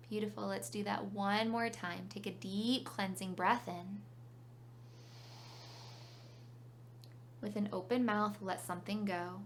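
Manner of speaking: calm meditation guide with long pauses; unhurried phrases, leaving room to breathe; guided meditation. Real, not acted.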